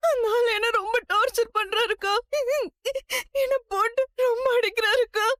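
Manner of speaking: sobbing between phrases; tearful plea to an elder sister; crying. Acted. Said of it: "Sita அழறாங்க". (அழுதுகொண்டே விம்மி பேசுதல்)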